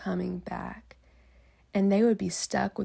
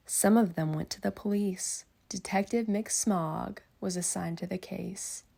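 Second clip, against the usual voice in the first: usual speaking voice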